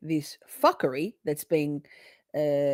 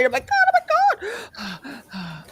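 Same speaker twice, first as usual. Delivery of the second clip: slow, breathless, higher oh my God; panting; 12 semitones higher, 5 dB louder, slower than usual. Real, not acted. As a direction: gasp it out of breath. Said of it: "Sorry, panting".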